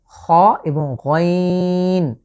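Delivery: খ and গইন both from the throat's end, drawn out; raspy খ, long গইন; back-throat letters. Shown as a demonstration. Teacher comparing two letters for viewers, throaty খ and গইন. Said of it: "উচ্চারণের স্থান এক".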